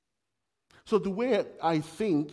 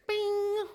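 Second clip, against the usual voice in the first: high sung ping; notification bing. Retold or imitated. Preacher imitating a social media notification sound. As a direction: imitate a phone alert chime.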